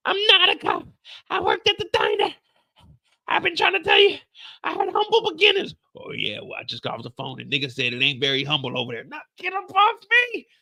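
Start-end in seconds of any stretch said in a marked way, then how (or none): 0.1-5.8 high-pitched woman defending herself. Acted. Voicing Jen defending herself. 9.0-10.5 high woman voice. Acted. Voicing Henry in a struggle.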